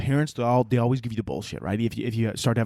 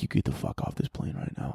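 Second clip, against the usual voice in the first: low, hushed menace; muttered threat; 12 semitones lower, 6 dB quieter than usual. Acted. Passenger glaring at a baby, low muttered threat. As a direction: mutter it under your breath.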